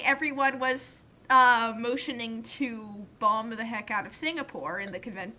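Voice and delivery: usual speaking voice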